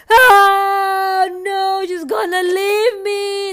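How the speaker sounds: high, loud mock sobbing; crying out loud. Imitating Mister C afraid she's gonna leave, crying out loud. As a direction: wail it slowly like a melodramatic crybaby. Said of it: "crying out loud".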